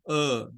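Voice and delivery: uh as a low weak hum, tongue still; lazy low uh from the throat; schwa sound. Shown as a demonstration. Teacher demonstrates the schwa vowel. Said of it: "El schwa suena a".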